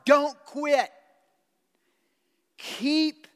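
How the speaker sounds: loud, high, urgent cry of exhortation; full-force preaching. Acted. Preacher urging the church not to quit, full-force preaching. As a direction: throw the words out to the whole room.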